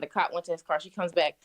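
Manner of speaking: usual speaking voice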